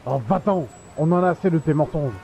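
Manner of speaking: irritated, fast brush-off; dismissive. Acted. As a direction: shoo him away. Neighbour sends him away.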